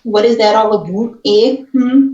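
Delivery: loud, quick put-on Canadian "eh"; exaggerated Canadian accent; mock Canadian accent. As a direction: overplay a Canadian, punching "eh? Hmm?". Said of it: "I could do a Shay Canadian".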